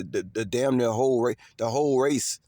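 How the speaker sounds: ordinary talk, nothing marked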